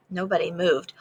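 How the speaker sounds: usual speaking voice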